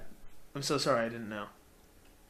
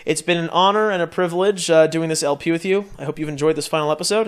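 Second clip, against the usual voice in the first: louder, formal host voice for the farewell; sign-off announcer; 7 dB louder than usual. Acted. Host closing the final episode to viewers, announcer sign-off. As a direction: address the audience like closing a show. Signs off series.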